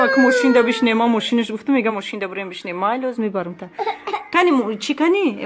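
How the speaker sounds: ordinary delivery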